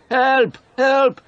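very high, loud panicked cries; shouting for help. A narrator's reading. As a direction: scream for help while being beaten. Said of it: "He shouted".

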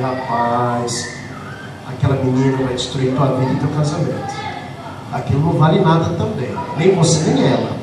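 bem mais grave e mais baixo, sério; advertência sombria em voz baixa; aviso grave e baixo. Retold or imitated. Pastor's stern low warning. (pastor adverte o rapaz em voz baixa e grave)

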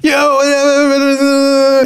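long high-pitched drawn-out yell of a greeting; exaggerated high vlogger call; mocked YouTuber greeting. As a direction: mimic his intro, held high and long. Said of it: "He's like".

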